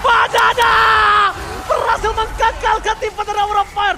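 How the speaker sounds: high, loud hype yell; announcer bellow for the big play; fighting game announcer. Real, not acted.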